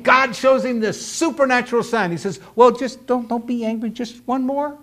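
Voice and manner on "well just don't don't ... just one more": high, quiet, hesitant mock plea; timid pleading. Gideon begging God for one more sign, timid. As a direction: stumble through a sheepish little request.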